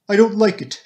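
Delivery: high, loud wail. A narrator's reading. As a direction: wail it out in distress. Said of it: "she wailed".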